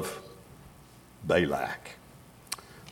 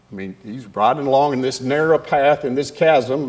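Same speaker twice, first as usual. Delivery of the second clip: normal talking voice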